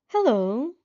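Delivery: put-on grinning through gritted teeth at an unwelcome boy; forced friendly greeting. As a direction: fake a smile you don't feel. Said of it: "You grin out, forcing yourself to play nice".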